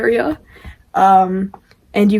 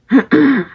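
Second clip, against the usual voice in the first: throat clearing; 6 semitones higher, 5 dB louder than usual. Shown as a demonstration. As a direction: clear your throat with a rasp. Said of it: "So you go".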